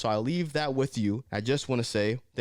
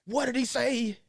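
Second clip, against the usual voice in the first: higher, mock shocked viewer; 10 semitones higher, slower than usual. Imitating viewers shocked at his words, higher. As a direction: act scandalised. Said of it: "you guys gonna be like".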